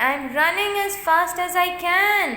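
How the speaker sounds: high-pitched, urgent bear protest; straining racing bear. A narrator's reading. Bear answers the turtle mid-race, protesting urgently. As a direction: voice the bear pushing to keep up.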